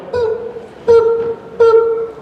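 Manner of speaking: three short high boops; high beep countdown. Shown as a demonstration. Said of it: "this is how it works".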